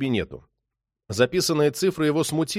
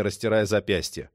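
usual speaking voice

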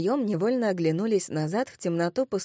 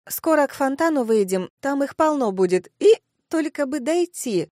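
high voice with a hiccup; tipsy encouragement; 6 semitones higher, 5 dB louder than usual. Acted. Girl urges sleepy friends toward fountain, tipsily encouraging. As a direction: keep them going, tipsily eager.

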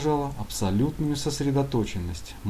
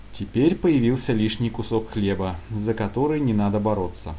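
ordinary delivery

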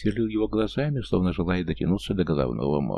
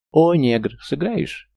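loud call; 9 semitones higher, 5 dB louder, much slower than usual. Acted. Swindler hails narrator.

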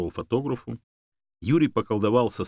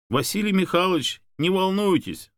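lightly voiced man, pitched up; reassuring interjection; 10 semitones higher, 3 dB louder than usual. A narrator's reading. Man telling a worried owner not to worry, reassuring. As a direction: step in earnestly to calm someone.

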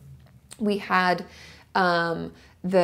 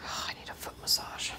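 tired whispered sigh; 13 dB quieter than usual. Real, not acted. Sighing aside, whispered.

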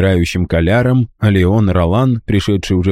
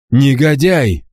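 higher, sudden cry of outrage; outcry; 6 semitones higher, slower than usual. A narrator's reading. Count to the dying villain, indignant outcry. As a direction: cry out in disgust. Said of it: "вскрикнул Декергац".

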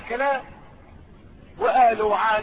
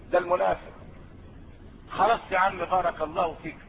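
usual speaking voice

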